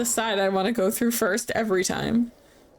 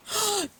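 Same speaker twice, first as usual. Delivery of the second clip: sharp, high surprised gasp; 10 semitones higher, 3 dB quieter than usual. Real, not acted. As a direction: catch your breath at a sudden realization. Recognizes ghost's grave.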